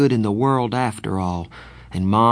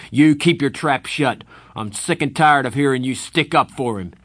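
angry snapping; 5 semitones higher, 5 dB louder than usual. Acted. Darry turns on Soda.

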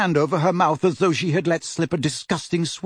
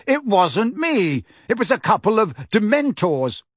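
higher, emphatic, slowing protest; temper rising; 7 semitones higher than usual. A narrator's reading. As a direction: insist hotly with the voice raised. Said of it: "temper and voice rising together".

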